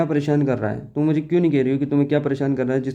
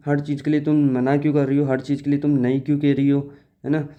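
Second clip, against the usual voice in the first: ordinary talk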